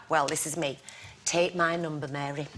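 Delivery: low, soft retold aside; confiding offer. Woman offering her number to the toilet attendant, low confiding tone. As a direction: murmur it low, as if slipping her a favour.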